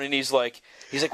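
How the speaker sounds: usual speaking voice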